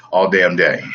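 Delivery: ordinary delivery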